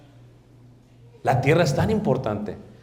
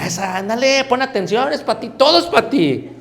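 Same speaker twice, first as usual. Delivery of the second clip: mocking church sister; 9 semitones higher, 4 dB louder than usual. Gossiping sister in church voiced.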